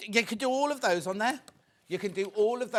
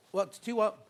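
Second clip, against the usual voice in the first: ordinary talk, nothing marked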